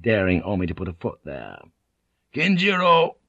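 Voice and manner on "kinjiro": high, forceful defiant call; shouted. A narrator's reading. Blackthorne daring Omi to come down, shouted. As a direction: call it out sharply. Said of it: "he said again".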